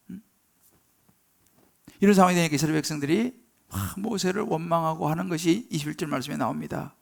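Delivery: ordinary delivery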